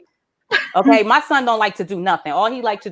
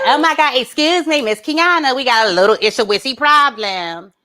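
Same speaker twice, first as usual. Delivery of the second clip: put-on complaining girl; 3 semitones higher than usual. Girl confronting his mother, put-on complaining voice.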